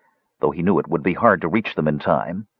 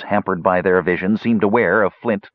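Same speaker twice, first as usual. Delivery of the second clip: ordinary delivery, nothing marked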